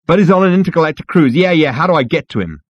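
quick, high, loud cut-in; impatient interruption. Acted. Zaphod cuts off the receptionist's excuse, impatient. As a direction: hurry past the excuse.

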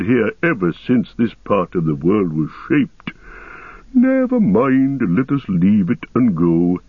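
ancient, unhurried ent voice; Treebeard voice. Acted. Treebeard repeating the word.

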